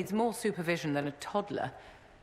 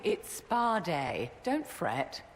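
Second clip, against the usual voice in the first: drawn-out; much slower than usual. Acted. Drawn-out reassurance repeated.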